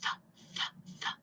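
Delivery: "th" in soft voiceless puffs; isolated "th", tongue under the teeth, repeated; TH sound demo. Shown as a demonstration. Teacher recapping what TH says, "th" as soft single puffs. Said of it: "the T H says".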